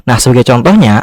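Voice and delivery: normal talking voice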